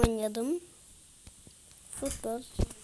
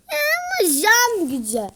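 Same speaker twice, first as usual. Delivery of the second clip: usual speaking voice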